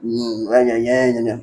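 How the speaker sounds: soft mock grumbling "né, né"; a sullen, half-hearted mumble; sulky mumble. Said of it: "aquela cara emburrada".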